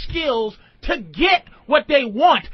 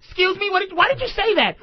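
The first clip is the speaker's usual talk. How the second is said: offended woman chasing; 7 semitones higher, faster than usual. Retold or imitated. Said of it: "she'll chase after you and go".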